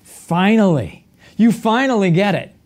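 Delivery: a touch of fond exasperation; exasperated relief. Retold or imitated. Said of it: "he's looking at them and saying".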